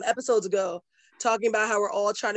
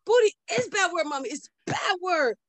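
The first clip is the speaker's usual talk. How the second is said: put-on a little boy correcting his mom; scandalised toddler; 8 semitones higher than usual. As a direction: son scolding mom in a high child voice. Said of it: "Booty is not bad word".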